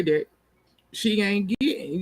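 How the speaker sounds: normal talking voice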